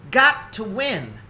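determined Emmett. Acted. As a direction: set his jaw and say it. Emmett resolves.

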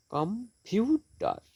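"Computer" slowly, পিউ pitched high; stress on second syllable. Shown as a demonstration. Teacher showing stressed syllables, stress the middle of "computer". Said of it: "second syllable stressed".

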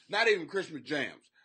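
normal talking voice

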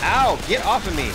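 loud pained yell; pained shout. Real, not acted. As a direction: yell at the attacker. Attacked in game.

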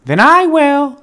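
a stubborn little hen; determined hen. A narrator's reading. The hen taking on the work herself, high and firm. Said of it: "said the little red hen".

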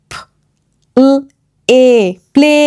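ফ, ল, এ as separate sounds, then প্লে blended; long 'ay' in এ, pause after each sound. Shown as a demonstration. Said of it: "এ long sound এ তৈরি করবে". (ধ্বনি ভেঙে ভেঙে, তারপর জুড়ে)

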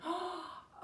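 a sharp mock gasp of delight; surprised gasp. Storyteller spots a child out in Storyland, delighted gasp. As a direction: gasp as if spotting a child in the crowd. Storyteller pretends to spot kids.

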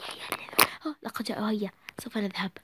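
quick hushed whisper; hurried whisper. Acted. Sisters slipping away unseen, whispering. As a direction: whisper to sneak off.

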